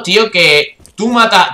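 usual speaking voice

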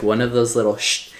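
ordinary talk, nothing marked

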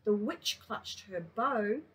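storybook narration; reading to children. A narrator's reading. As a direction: read it to little kids. Reads a picture book to kindy.

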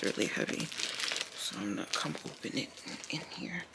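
soft unvoiced whisper. Real, not acted. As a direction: breathe it out secretly. Opening heavy package.

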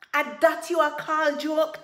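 high, very loud angry question; incredulous roar. A narrator's reading. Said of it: "Tiger roared".

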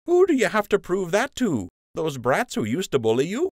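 cheeky loyal sidekick; fond teasing friend. A narrator's reading. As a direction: rib him like an old pal. Lund voiced by narrator.

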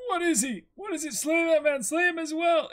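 high, loud hammed-up panic; a frightened soldier, pitched high and loud; panicked soldier. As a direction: shriek the orders in terror. Voicing panicked enemy soldiers.

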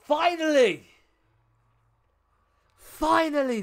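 high, elated cry of relief; triumphant. Real, not acted. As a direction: let it burst out after a long grind. Celebrating reaching Diamond.

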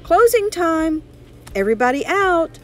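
high, loud announcing call. A narrator's reading. Manager calling to all the laundromat's customers, calling out. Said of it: "the manager of the laundromat called".

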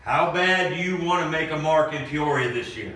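ordinary talk

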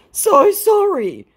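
high, loud, drawn-out whine; hammed-up grovelling; mock apology. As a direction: apologize slowly in a squeaky put-on voice. Voices scammer's apology.

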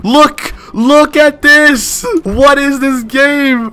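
high, stretched-out protest; high-pitched, drawn-out frustration; exasperated outcry. Real, not acted. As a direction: wail slowly in disbelief at the game. Game not working.

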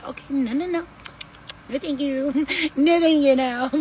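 high, playful no, thank you; sassy refusal. Shown as a demonstration. Refusing other people's energy, high and sassy. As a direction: wave it off with a laugh. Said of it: "I'll push the energy".

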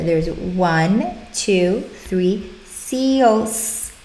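slow count, long hissed S on "seals"; stress the S. Shown as a demonstration. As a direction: drag the count and lean on the S of "seals". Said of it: "We would emphasize the S".

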